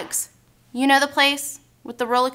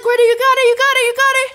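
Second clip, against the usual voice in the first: urgent encouragement; 10 semitones higher, 5 dB louder, faster than usual. Retold or imitated. Friend urging rider on during drop.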